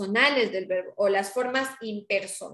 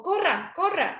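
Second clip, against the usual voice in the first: urging order; 7 semitones higher than usual. Shown as a demonstration.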